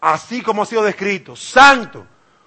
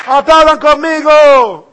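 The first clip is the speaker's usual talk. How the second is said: mock cheerful invitation; 6 semitones higher, 8 dB louder, slower than usual. Mocking image of Saul worshipping.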